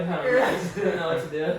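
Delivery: high, loud words through laughter; laughing helplessly. Retold or imitated. Narrator answering sister, laughing high and loud. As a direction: giggle out the excuse. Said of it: "I'm like".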